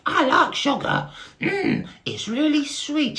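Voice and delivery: very high, loud squeaky beetle voice; high squeaky Bart. Acted. Dim little brother greeting Barry, high squeaky voice. Bart talks about sugar.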